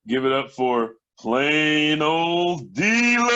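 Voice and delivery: loud, drawn-out stage announcer call; announcer introduction. Real, not acted. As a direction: stretch the name out big, rising high at the end. Host brings on the next comic.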